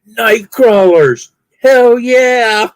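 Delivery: high, slow, proud shout with a laugh; 1 triumphant guy. Retold or imitated. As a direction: gloat to the fisherman who taught him. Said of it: "and he goes".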